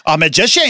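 magician twisted into "ma-ji-shay-on"; mock mispronunciation. Shown as a demonstration. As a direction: playfully mispronounce magician with a stretched ending. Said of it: "do you call it something different?".

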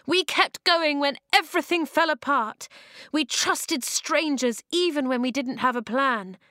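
rally the tribes, voice up high; rousing speech. Acted.

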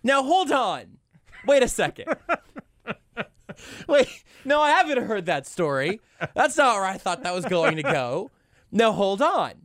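high-pitched mock indignation through laughter; mock disbelief. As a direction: object in squeaky, laughing disbelief. Host feigns outrage at punchline.

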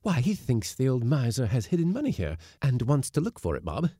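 wife guessing the landlord's motive, excited; excitedly. A narrator's reading. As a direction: rush it out, excited. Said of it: "This excitedly".

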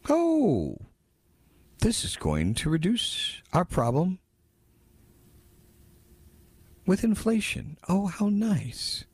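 high, mocking "Oh", then a slow, drawn-out "how nice"; sarcastic mock quote. Host ridiculing the claim that the bill reduces inflation, sarcastic. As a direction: sneer at the promise in a put-on high voice.

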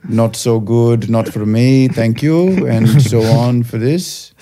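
put-on deliberate Indian-accented reproach, phrase by phrase; imitated Indian accent. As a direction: lecture wearily as the Indian takeaway man. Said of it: "stuck in the accent too".

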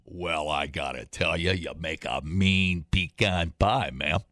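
a lightly voiced cowboy, low and slow; John Wayne drawl. Host doing John Wayne to cohost, lightly drawled. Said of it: "Seems like John Wayne used to call everybody ma'am".

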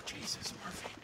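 hushed awe in a whisper. Real, not acted. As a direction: breathe it out in a whisper. Awed whisper at the forest.

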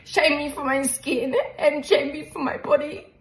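tearful voice breaking; sobbing through the words; crying. Real, not acted. Recalls bullying, crying on camera.

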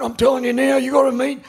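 high excited mate voice; mock excited Aussie bloke; imitating Sweaty. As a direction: hype him up like a wound-up mate. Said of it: "he was fucking sweating".

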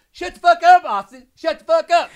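high, loud hammed-up screech; mock screaming. Podcaster imitating Madison screaming at Austin, mock shriek. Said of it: "and she's like".

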